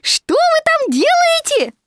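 high, slow loud shout; Piglet calling. A narrator's reading. Piglet calls up into the tree, shouting. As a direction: call it upward, drawn out. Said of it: "крикнул Пятачок".

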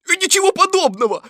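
high, loud, quick interruption; rapid, high tiger objection; protesting tiger. A narrator's reading. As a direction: cut in fast with a denial. Tiger interrupts rabbit to deny.

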